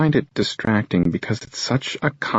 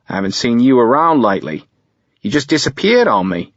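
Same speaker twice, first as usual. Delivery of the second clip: higher, louder Australian voice; Australian accent; 4 semitones higher, 5 dB louder than usual. A narrator's reading. An old hookup reproaches Jace for vanishing, Australian accent. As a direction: chide him lightly in an Australian accent. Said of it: "His Australian accent is his tell".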